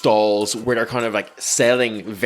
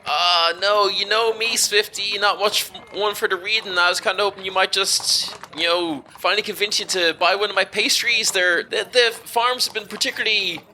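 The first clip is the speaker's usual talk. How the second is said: friendly stallholder; 8 semitones higher than usual. Acted. Pastry seller coaxing Swifty to buy, friendly stallholder. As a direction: coax a regular customer in a higher voice.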